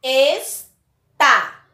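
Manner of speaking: "Está" as Es... tá, both loud; slow classroom syllable break in "Está"; split into syllables. Shown as a demonstration. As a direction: say "Está" in two detached, forceful syllables. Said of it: "Então, separe".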